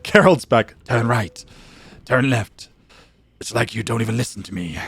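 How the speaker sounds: lightly voiced gruff game-character navigator; Geralt impression. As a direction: give terse directions like a grumbling monster hunter. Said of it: "he literally is like".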